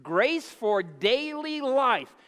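"Grace for daily life" slow and loud, each word weighted; deliberate preacher stress on "Grace for daily life"; slow emphatic repeat. Shown as a demonstration. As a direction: draw it out for the room to echo. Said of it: "Say that with me".

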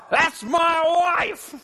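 slow, high proud shout; husband hollering about his wife to the church, proud shout. Shown as a demonstration. Drawn-out loud boast. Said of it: "You know what ought to happen".

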